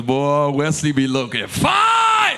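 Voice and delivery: slow drawn-out shout of admiration; gushing shout. Acted. Preacher teasing youth about a crush, slow loud gush.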